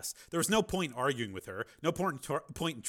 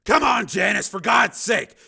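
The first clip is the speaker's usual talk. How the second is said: loud, higher, fed-up outburst; exasperated shout; 11 semitones higher, 16 dB louder than usual. Acted. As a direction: Steele snapping at Janice for doubting him, exasperated shout. Steele exasperated at ex-wife.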